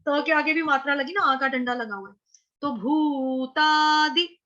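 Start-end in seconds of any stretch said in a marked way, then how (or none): 2.6-4.4 भूतादि stretched very slowly with a long भू; slow teacher demo of भूतादि; slow syllable demo. Shown as a demonstration. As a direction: draw out भूतादि, holding the long u. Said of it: "द के साथ जो मात्रा है वो छोटी है".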